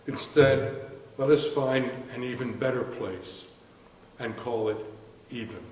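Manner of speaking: measured, emphatic poetry reading with falling line ends; poem recital. Acted. Poet reads a friend's poem to an audience, measured recital. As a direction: recite it in deliberate phrases, softening at the close.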